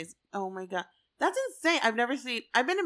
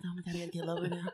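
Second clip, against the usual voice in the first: whispering; 5 semitones lower, 11 dB quieter, much faster than usual. Retold or imitated. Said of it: "Jenna got lower, so I was like".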